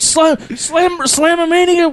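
high-pitched mock wrestler bellow; wrestler promo impression. Quoting the wrestler's botched promo, high hammy shout. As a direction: fumble the event name in a high wrestler voice. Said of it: "He's like".

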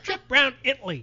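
put-on high shouted old lady voice; grandmother voice. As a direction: yell it like a deaf grandma. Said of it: "She's like".

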